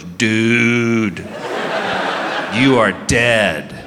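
low, slow doom-laden friend; ominous buddy. Retold or imitated. Friend warning him he is doomed, low and slow. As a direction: drag it out like a death sentence. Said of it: "my friend goes".

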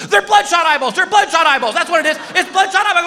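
high, loud insistent guess; wife yelling guesses. Retold or imitated. Wife shouting guesses at Pictionary, insistent. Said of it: "She goes".

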